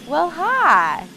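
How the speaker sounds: imitated Southern drawl, high then falling; southern accent. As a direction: mimic a cat with a Southern accent. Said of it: "speak in a southern accent".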